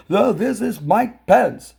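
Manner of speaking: ordinary talk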